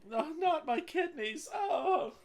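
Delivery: very high, loud, drawn-out wail; hammed-up shriek of pain; screaming victim. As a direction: play a wounded enemy howling over his kidneys. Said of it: "He's screaming".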